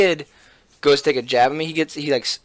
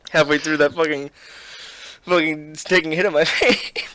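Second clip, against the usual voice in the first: higher, louder, broken by laughter; real laughing fit while explaining; choked laughing; 5 semitones higher, 3 dB louder than usual. Real, not acted. He laughed halfway through a vape hit, choked laughing.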